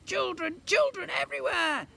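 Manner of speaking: high, loud panicked cries; screaming. A narrator's reading. Twin overrun by children, high panicked scream. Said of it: "screamed Edmond".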